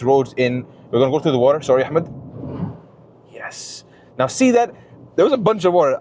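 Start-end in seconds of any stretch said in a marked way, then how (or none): 3.3-3.8 whispered. Real, not acted. Quiet aside to passenger. 4.2-6.0 excited. Real, not acted. Excited after driving through water.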